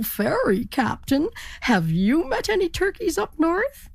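thrilled to meet the captain; excited turkey. Acted. Turkey asks ferry captain.

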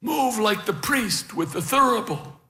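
put-on high, measured old man's instruction; lightly voiced elderly teacher; careful coaching. A narrator's reading. Old beekeeper showing helpers how to move, careful.

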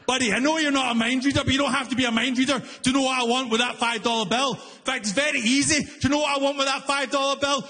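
high, fast exasperated rant; losing it. Retold or imitated. Comic to booth worker, ranting fast and high. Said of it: "I lost it".

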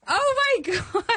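very high-pitched squeal, re-enacted; starstruck shock. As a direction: squeak out mock-overwhelmed disbelief. Retelling her shock at star's call.

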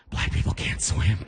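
hushed whispered aside; mock whisper. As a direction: whisper it like a secret. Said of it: "why are you whispering?".

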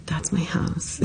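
hushed, unvoiced whisper to herself; whispered aside. Prisoner whispering as the van passes her home, hushed. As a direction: breathe it out in disbelief. Driving past her own apartment.

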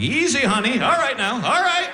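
high, nervously placating; nervous gazelle. Acted. Comic squeezed by sleeping wife, nervously placating. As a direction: placate her in a rising, high voice. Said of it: "I was like a gazelle".

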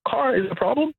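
Car pitched very high in mocking disbelief; incredulous mock question. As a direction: throw the question up high, scoffing at the rule. Caller mocks the rule in disbelief.

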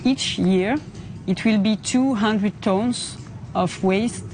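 normal talking voice

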